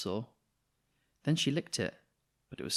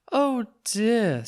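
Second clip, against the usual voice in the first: worried Mrs Thomas; 12 semitones higher, 7 dB louder than usual. Acted. Mrs Thomas worried about paw.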